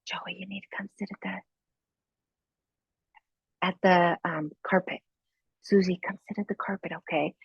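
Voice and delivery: soft teacher whisper to the class; quiet whispered call to the children. Retold or imitated. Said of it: "I would actually get quieter".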